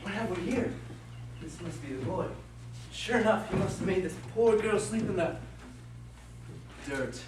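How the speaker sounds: a mischievous sprite pleased with his own trick; Puck the sprite. Acted. Puck voiced by the same reader.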